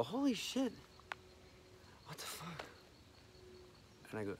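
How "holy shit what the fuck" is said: soft startled gasp of words; hushed shock. Retold or imitated. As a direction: act out waking to an intruder. Acts waking to intruder in dark.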